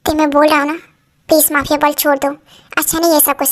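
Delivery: usual speaking voice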